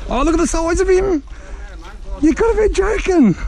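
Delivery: high, amazed exclamation at the catch; surprised. Real, not acted. Angler shocked at a big fish on the line, surprised high outburst. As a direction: blurt it out in real astonishment.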